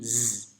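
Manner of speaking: a held "z" with buzzing vocal cords; voiced Z sound. Shown as a demonstration. Teacher demonstrating English consonants to learners, voiced Z. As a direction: let the "z" hum and buzz. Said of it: "bunyi yang bergetar pita suara kita".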